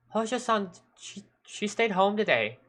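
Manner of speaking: put-on high, hesitant girl's voice; timid schoolgirl. Underclassman answers the policeman honestly, timid schoolgirl. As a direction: stammer it out in a small high voice. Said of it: "to cringe in fear".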